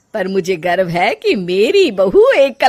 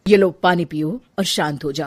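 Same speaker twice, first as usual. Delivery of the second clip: lower, softer, gentle; calm her down kindly; soothing; 6 semitones lower, 4 dB quieter than usual. Acted. Husband offering water to crying wife, soothing.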